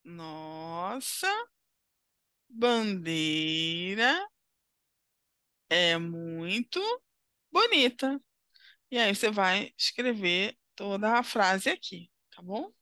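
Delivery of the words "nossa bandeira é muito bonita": slow dictation. Shown as a demonstration. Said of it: "você vai ordenar essa frase".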